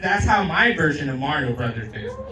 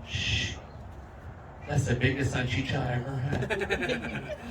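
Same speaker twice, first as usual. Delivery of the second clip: soft, low, hushed moan; hushed mock Raven; 4 semitones lower, 9 dB quieter than usual. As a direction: savor it quietly. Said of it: "Raven's gonna go".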